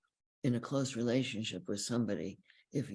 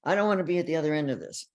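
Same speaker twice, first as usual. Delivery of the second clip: defensive snap; 4 semitones higher, 6 dB louder, much faster than usual. Retold or imitated. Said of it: "might get angry and say".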